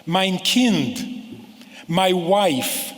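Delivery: lovingly voicing "Mein Kind" in old Yiddish style; old-time Yiddish address. Shown as a demonstration. Rabbi recalling how elders addressed their kids, fond Yiddish. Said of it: "people used to speak like this".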